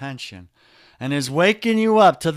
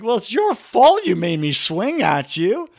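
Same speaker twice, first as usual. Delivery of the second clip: higher, louder mock accusing wife; mock wife blaming; 7 semitones higher, 5 dB louder than usual.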